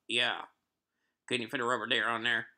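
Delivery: quick, thick Southern twang; worst southern accent. Retold or imitated. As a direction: do an over-the-top Southern customer. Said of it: "the worst southern accent that you could possibly".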